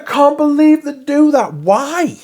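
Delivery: mock dismay. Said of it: "I was like".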